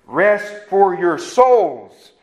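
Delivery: very slow "Rest ... for ... your ... souls", spaced out; word by word. Retold or imitated. Preacher showing the words in his Bible, "Rest for your souls" slow and spaced. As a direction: spell out "Rest for your souls" one deliberate word at a time.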